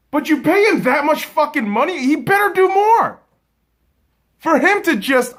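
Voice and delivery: high, loud exasperated rant; rising indignation about his pay. Real, not acted. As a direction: shout your frustration at the overpaid quarterback.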